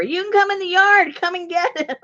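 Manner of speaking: high, loud call out the door; hollering out the door. Retold or imitated. Marie calling to the dog owners from her door, high holler. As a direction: holler it across the yard. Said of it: "she hollers out the door".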